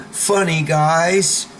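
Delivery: high, forced nervous chuckle, words drawn out; put-on people-pleasing giggle; fake overly nice. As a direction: laugh it off weakly to placate a bully. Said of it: "you pretend you're overly nice".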